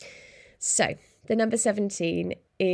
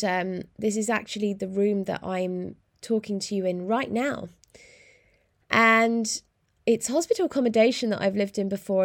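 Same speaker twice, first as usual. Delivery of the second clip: normal talking voice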